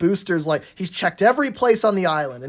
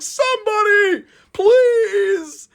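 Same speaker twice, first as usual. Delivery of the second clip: high, slow, thin cry for help; a small engine pleading from afar; calling for help; 4 dB louder, much slower than usual. Acted. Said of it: "he's calling out for help".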